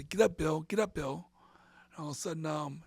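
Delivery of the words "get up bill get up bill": firmer, punchier retold urging. Wrestler recalls getting back up in the match, firm urging. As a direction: urge him up, like mid-match. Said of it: "I got back on up to".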